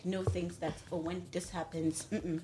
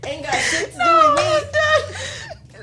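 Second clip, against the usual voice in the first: anger turning to laughter; 11 dB louder than usual. Real, not acted. Demanding anal beads, then laughing.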